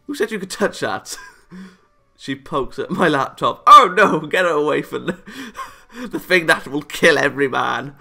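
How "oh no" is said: ordinary delivery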